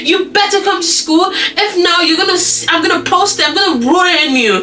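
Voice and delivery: high-pitched, panicky acted threats; retold panic. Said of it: "He's like, panic".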